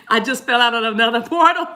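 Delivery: real laughter through the words; laughing. Real, not acted. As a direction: crack up while talking. Cracks up at losing her place.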